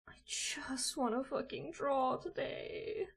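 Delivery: hissed whisper-shout, slow; real desperate whisper; frustrated whisper. Real, not acted. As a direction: whisper it through clenched frustration. Frustrated at software.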